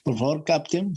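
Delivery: normal talking voice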